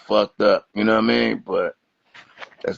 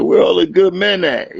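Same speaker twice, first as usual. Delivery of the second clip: mock lonely woman; 7 semitones higher, 4 dB louder than usual.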